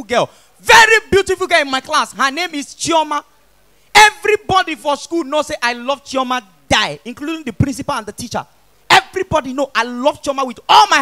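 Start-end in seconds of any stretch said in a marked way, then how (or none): none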